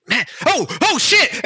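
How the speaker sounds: rising from surprise to a high shout of alarm; startled cry. Acted. Bird nearly hitting something mid-flight, high startled cry. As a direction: yelp as the wings nearly clip something. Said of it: "and he goes".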